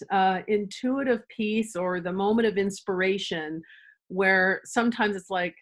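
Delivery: normal talking voice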